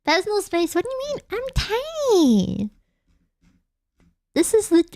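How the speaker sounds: tiny, very high mock-indignant squeal; high, playful whine of innocence; high-pitched protest. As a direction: protest in a little squeaky voice, drawing out the end. Mock protest at being too close.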